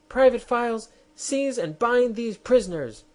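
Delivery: high, loud shrill scream of command; enraged queen screaming at her private. Acted. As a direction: scream the order in fury. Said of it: "screamed the Queen".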